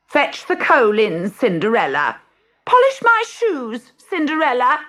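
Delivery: high, loud, shrill-edged commands from a spoiled sister; bossy stepsisters. Acted. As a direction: order her around like a spoiled brat. Stepsisters bossing Cinderella.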